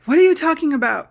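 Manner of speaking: defensive retort. Retold or imitated. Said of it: "And I was like".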